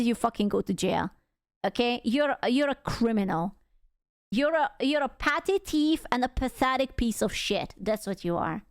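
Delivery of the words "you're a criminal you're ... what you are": ordinary delivery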